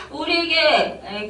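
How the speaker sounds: normal talking voice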